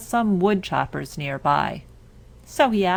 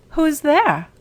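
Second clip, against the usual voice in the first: grandmother calling; 9 semitones higher, 6 dB louder than usual. Acted. Grandmother calls from bed.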